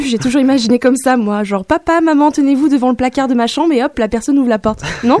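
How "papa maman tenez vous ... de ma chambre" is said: higher-pitched theatrical call to the parents; mock-solemn announcement, pitched up; imagined coming-out announcement. Child to parents before the closet, theatrical announcement.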